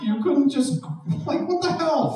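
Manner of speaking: ordinary delivery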